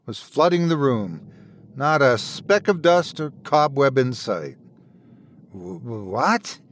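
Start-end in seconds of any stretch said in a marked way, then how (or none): 5.5-6.6 stammered, startled what; sputtering surprise. Acted. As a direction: sputter awake in confusion. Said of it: "he sputtered".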